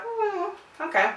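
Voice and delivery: high, soft, shrugging "okay"; lightly voiced relaxed dog; unbothered dog voice. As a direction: voice the dog as unimpressed. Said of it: "he was like".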